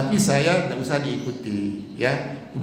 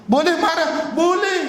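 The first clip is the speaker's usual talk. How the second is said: high, bright question and answer; rhetorical high question; 12 semitones higher, 4 dB louder than usual. Real, not acted. To the congregation, a lifted rhetorical question and answer. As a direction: pose it high and answer yourself.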